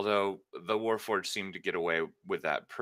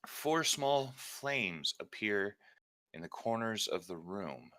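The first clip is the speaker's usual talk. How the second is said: normal talking voice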